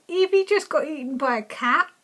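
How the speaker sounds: ordinary delivery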